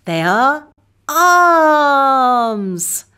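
each word drawn out slowly; slow and clear. Shown as a demonstration. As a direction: model the words slowly for kids. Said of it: "What are they?".

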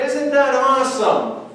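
mock-thrilled, drawn-out awesome; mock excited. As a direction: overplay the thrill at the convert. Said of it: "We get all excited".

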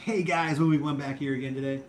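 hammed-up vlogger intro, held high; movie one. Greeting returning viewers, movie one impression. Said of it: "that's my movie one impression".

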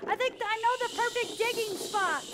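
shout over wind. Acted. Child calling to the crew over the storm, shout over wind.

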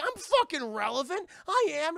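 high, loud indignant protest; offended insistence. Retold or imitated. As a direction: insist loudly, stung and defensive.

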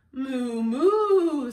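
loud, drawn-out moos rising in pitch; two moos, the second bigger; cow moo. A narrator's reading. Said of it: "says Cow".

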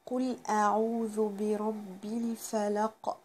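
slow, drawn-out tajweed reading; slow chanted Quran line; slow recitation. Shown as a demonstration. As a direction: stretch each syllable as a model. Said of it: "كل حرف القاف ماله مضموم".

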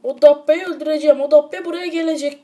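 loud mock threat from the sausage; vengeful sausage hero. Sausage vowing to kill Dabbe, loud mock threat. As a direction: play the sausage vowing revenge. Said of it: "bu da diyor".

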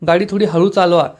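usual speaking voice

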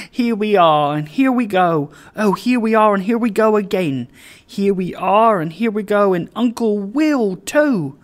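high, loud, bouncing singsong delight; exuberant. A narrator's reading. Father trotting about with the child, exuberant. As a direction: chant it merrily like bouncing a child.

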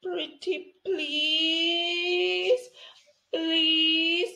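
high, drawn-out pleading please; begging. Real, not acted. Youtuber pleading with viewers for their help. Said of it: "I'm begging".

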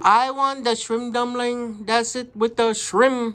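customer's put-on Chinese accent. Customer phoning restaurant to order, in a Chinese accent. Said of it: "we're gonna do a Chinese accent".

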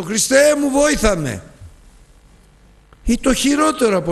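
high, loud fiery preaching; preaching at full force. Acted. Preacher rebuking the congregation about self-will, fiery and high. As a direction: drive the rebuke home to the congregation.

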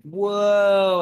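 long, high, loud gawking whoa; drawn-out slack-jawed amazement; open-mouthed whoa. Acted. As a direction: gawp it out loudly. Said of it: "he's got his mouth open like".

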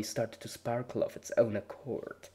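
ordinary delivery

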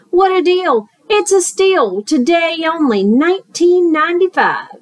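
high, loud huckster sales patter; salesman pitch. Acted. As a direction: sell it like a TV ad. Fox doing salesman pitch.

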